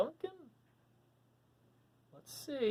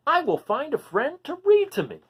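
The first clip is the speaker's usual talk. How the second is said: excited dragon voice; 8 semitones higher, 8 dB louder than usual. Acted. Duncan's bright idea in story.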